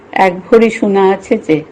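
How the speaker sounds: ordinary delivery, nothing marked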